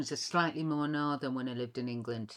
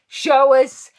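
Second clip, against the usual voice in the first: loud demand; 7 semitones higher, 14 dB louder than usual. Retold or imitated. Demanding audition panel, retold.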